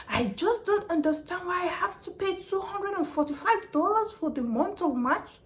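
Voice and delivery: high, whiny complaining caller; mock the caller who won't listen; mimicking an angry customer. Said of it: "He does not want me to talk".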